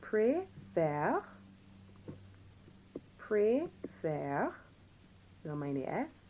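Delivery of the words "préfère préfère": "Préfère" in two separate syllables, rising at the end; teacher modelling "Préfère" in careful separated syllables; changed accent. Shown as a demonstration. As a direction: sound out "Préfère" for learners, open è lifted upward. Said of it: "we see that this accent is changed".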